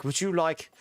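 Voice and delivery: retold posh waiter voice. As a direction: put on a posh server. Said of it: "They were so posh".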